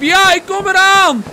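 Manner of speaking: high, loud shout back; calling out. Acted. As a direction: yell to someone far away that help is coming. Answering the friend's cry for help.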